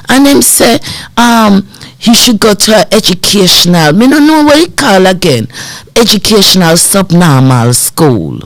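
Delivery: strong Jamaican patois; mum's Jamaican accent. Retold or imitated. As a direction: imitate a Jamaican mother searching for the term. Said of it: "she had a strong Jamaican accent".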